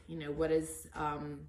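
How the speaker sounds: ordinary delivery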